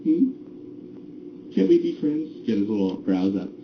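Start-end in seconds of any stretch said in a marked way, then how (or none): 0.0-2.3 hopeful character voice. Acted. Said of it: "you go".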